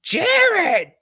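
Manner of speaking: high, loud shout of a name across the gym; coach's yell. Retold or imitated. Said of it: "and he goes".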